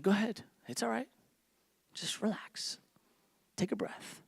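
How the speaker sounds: soft, hushed comforting whisper; calm a crying stranger in a whisper; soothing whisper. Retold or imitated. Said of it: "I'm like".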